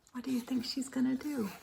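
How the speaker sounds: soft, quick aside; aside to listeners. Real, not acted.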